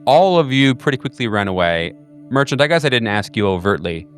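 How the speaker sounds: ordinary delivery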